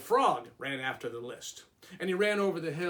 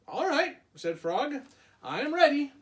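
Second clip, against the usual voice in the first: very high, louder cheerful Frog; 10 semitones higher, 6 dB louder than usual. Acted. Frog agreeing to the walk, very high. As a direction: pitch Frog way up, eager.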